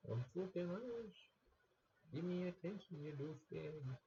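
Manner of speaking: very high put-on cat voice, at normal volume; squeaky pushy cat demanding attention. The cat demanding attention from his owner, very high squeaky voice. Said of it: "That's me doing the voice quietly".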